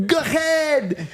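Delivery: high, drawn-out heckler's call; heckling call. Acted. Imitating a friend yelling at the host, heckling call. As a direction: call it out across a theater.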